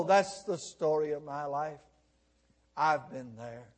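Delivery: low, softened confession; rueful admission. Acted. Preacher voices worshippers admitting their story, low and rueful. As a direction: let it drop into quiet recognition.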